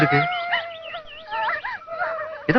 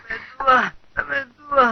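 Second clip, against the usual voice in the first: ailing moan; 5 semitones higher, 5 dB quieter, much slower than usual. Acted. Ailing patient being tended.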